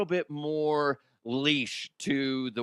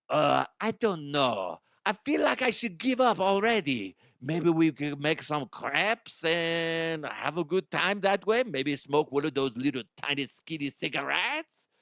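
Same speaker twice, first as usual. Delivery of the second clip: put-on French accent. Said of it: "Frenchie DeHuff".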